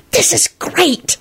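high, drawn-out delighted hiss; excited hiss. A narrator's reading. Solange to Quentin beside her, excited high hiss. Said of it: "she hissed to Quentin".

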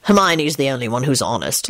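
lightly voiced low male character; low, measured verdict; pronouncement. A narrator's reading. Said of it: "Lupin pronounced".

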